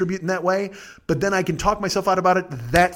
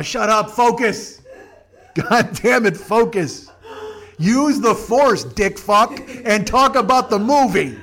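louder, half-laughing mock yell; mock angry shout; 3 semitones higher, 3 dB louder, slower than usual. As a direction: bark orders like frustrated fans, laughing through it. Imagined fans yelling at him.

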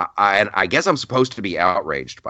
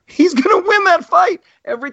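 giggling; 6 dB louder than usual. Real, not acted. Laughing at imagined redneck fight.

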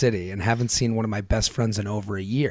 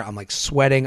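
usual speaking voice

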